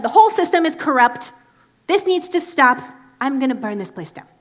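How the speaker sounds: loud, high mock outrage; fed-up Jesus. Jesus condemns the temple market, loud mock fury. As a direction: declare the system corrupt with fiery resolve. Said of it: "clearly Jesus is like".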